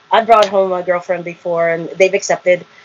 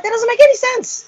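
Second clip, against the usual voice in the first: high-pitched exasperated protest, retold; angry retort. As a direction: protest indignantly, voice shooting up. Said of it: "I started getting angry at him".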